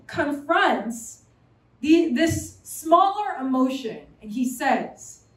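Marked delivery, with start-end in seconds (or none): none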